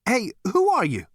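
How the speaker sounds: high, loud, alarmed questioning; panted demand. A narrator's reading. Zaphod demanding who is there, panting alarm. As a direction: call out urgently, pitched high. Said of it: "panted Zaphod".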